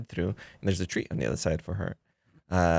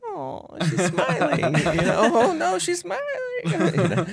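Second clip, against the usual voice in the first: laughing mock panic; 13 semitones higher, slower than usual.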